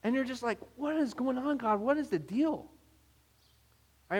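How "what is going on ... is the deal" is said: higher-pitched baffled complaint; exasperated. Acted. Frustrated believer asking God about others' blessings, exasperated.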